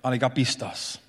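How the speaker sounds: hushed, disappointed Jesus; a soft, low sigh of a rebuke. Retold or imitated. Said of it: "Jesus said".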